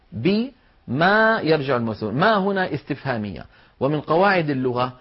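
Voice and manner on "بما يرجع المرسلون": slow "بما" split into bi, pause, maa; الأصل مفصولًا. Shown as a demonstration. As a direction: break "بما" after bi, then a long maa. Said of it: "هذه أصلها". («بما» مفصولة: الباء ثم ما)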